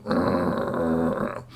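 low growling grunt; scowling growl. Acted. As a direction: growl it like a grumpy pirate. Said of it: "Young Timothy scowled".

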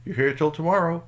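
brisk, loud ranger checking up; park ranger. Acted. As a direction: question a camper, rising on the last words. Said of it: "park ranger looked at it and said".

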